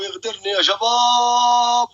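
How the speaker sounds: high, drawn-out wail to the team; urgent cry out. Real, not acted. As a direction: stretch the last word into a call. Cries for help, battle ending. (استغاثة عالية ممدودة)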